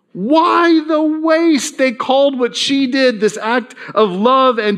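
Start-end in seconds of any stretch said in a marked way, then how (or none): 0.1-1.8 slow, higher, disapproving question; scolding disciples. Acted. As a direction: scold her, stretching every word. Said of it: "scolded her and said".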